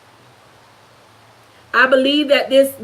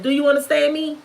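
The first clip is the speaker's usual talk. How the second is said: normal talking voice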